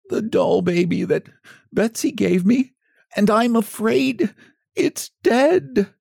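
high, slow, tearful little voice; a scarecrow grieving over the doll, half in tears; mildly sobbing. A narrator's reading. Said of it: "poor Jimmy Scarecrow sobbed".

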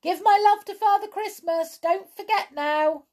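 high, loud holler; yelling after them. A narrator's reading. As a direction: yell a reminder to someone running away. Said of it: "The brownie yelled after them".

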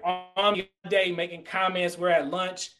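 normal talking voice

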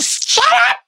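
very high enraged screech; furious shriek. Acted. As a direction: scream it in fury. Acted Bellatrix rage.

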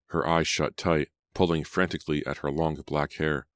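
ordinary delivery

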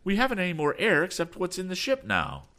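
high, urgent alarm; urgent high-pitched dismay; alarmed cry. A narrator's reading. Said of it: "the young inventor cried".

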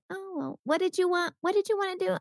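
high-pitched tentative curiosity; coming round. Acted. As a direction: play a partner softening, pitched high. Partner coming round to the idea.

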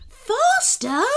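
very high sloth holler; hollered question. A narrator's reading. Sloth driver checking Kenny's order, hollering. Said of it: "hollered Sebastian".

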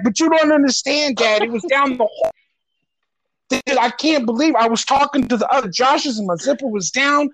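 high, whiny panic as the mortified son; embarrassed panicking son. Retold or imitated. Son panicking about open zipper.

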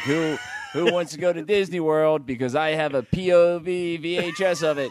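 high, loud hyped salesman voice; excited pitchman voice. Retold or imitated. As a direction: sell it like a TV promo guy. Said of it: "He's like".

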